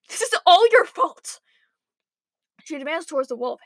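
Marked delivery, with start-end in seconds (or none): 0.1-1.4 high, strained accusing screech. A narrator's reading. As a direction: keep screeching in outrage. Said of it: "she screeched".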